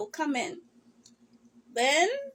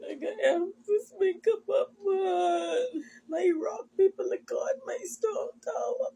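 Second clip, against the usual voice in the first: higher, tearful voice breaking; crying; 5 semitones higher than usual. Real, not acted. Woman crying to viewers about losing her mother, voice breaking high. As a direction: fight through the tears. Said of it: "Maybe that's why I crying now".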